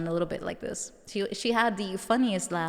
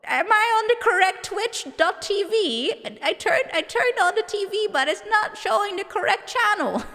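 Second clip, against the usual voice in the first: put-on confused old lady voice; 11 semitones higher, 3 dB louder than usual. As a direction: play a lost old lady on the internet. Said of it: "go like".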